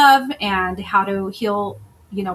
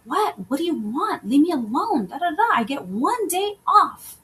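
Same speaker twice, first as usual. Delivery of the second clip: higher, exasperated mock yell of a worn-out mother; retold mom yelling; 7 semitones higher than usual. Mother overwhelmed after a six-day week snaps at son, mock yell. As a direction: snap it out like an overwhelmed single mom. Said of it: "yelled at him and was like".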